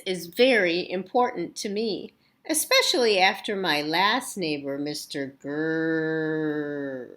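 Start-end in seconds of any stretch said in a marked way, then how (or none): 4.8-7.1 slow, low growl on "Grrr"; reading the mean neighbor's name, growling. Acted. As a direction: growl the name like a cranky dog.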